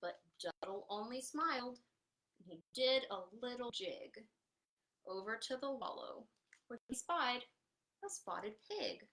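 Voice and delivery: lively picture-book reading; animated storytime narration; reading to children. A narrator's reading. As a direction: read it out to a circle of little kids.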